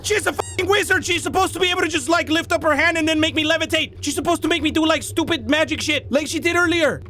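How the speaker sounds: high-pitched frantic ranting; frantic, indignant high wail; panicked rant. Real, not acted. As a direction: yelp it all out in a high panic. Panicked rant while being chased.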